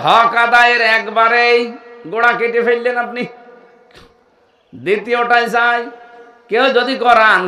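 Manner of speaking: loud, high, forceful declaration; fiery preaching. Real, not acted. Preacher scolding the crowd at full force, loud. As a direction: drive the point home to the crowd.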